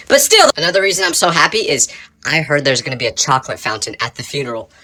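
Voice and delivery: usual speaking voice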